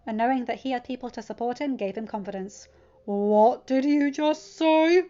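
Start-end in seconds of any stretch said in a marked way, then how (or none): 3.0-5.0 louder, slow menacing hiss; hissed threat. Acted. Bully hissing back at Peter, menacing. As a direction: threaten him through the teeth. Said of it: "Flash hissed out".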